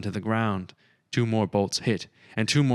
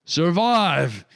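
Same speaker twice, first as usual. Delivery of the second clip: a loud, much higher yell with a falling end; 9 semitones higher, 7 dB louder than usual. A narrator's reading. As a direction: throw the word out like a final order. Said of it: "yelled Reginald".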